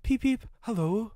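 high whistled peep and hello; friendly engine whistle. A narrator's reading. Kind engine greeting Henry in the tunnel, high whistled hello. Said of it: "would come through and whistle".

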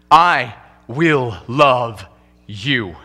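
slow, tender bride's vow. Acted. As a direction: draw each word out gently, like a bride at the altar.

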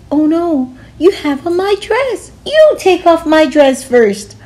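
high, indignant squeal; indignant teacher. Acted. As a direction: snap back at the principal. Teacher argues back.

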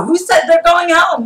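high, loud rebuke of the question; shouted challenge. Shown as a demonstration. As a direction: hurl the question at the crowd. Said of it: "repeated challenge, now shouted".

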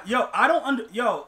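ordinary talk

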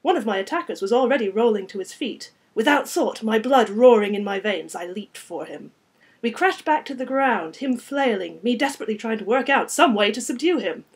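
loud, driving action narration; intense, projected storytelling; dramatic story narration. A narrator's reading. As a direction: read the fight at full tilt. Reads fight scene aloud.

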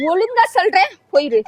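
angry warning. Real, not acted. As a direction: warn off a pest, losing patience. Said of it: "கோவப்படாதீங்க".

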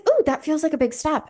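high, bright ooh of awe; mock gasp. Telling herself, a mock gasp at the big step. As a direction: squeal it like a big revelation. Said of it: "I was like".

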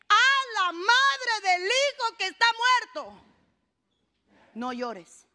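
slow, high, stretched-out declamation; dramatic preaching. Acted. Preacher sets up Jesus's words to the grieving mother, dramatic declamation. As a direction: draw every word out high for the congregation.

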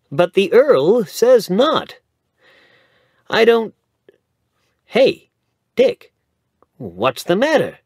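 bright rising lawyer voice building to alarm; chatty young man growing surprised; young lawyer, lively. A narrator's reading. Young lawyer hands Dick a paper, lively then startled.

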